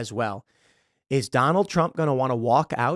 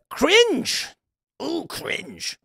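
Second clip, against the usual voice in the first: mock disgust; 11 semitones higher, much slower than usual. Real, not acted. Mocking reaction.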